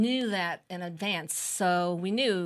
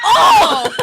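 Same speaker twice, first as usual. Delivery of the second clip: very high and loud, laughing; laughing realization shout; 17 dB louder than usual. Retold or imitated. Mother realizing her husband isn't going, laughing shout. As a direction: crack up as it dawns. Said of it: "that's when I went".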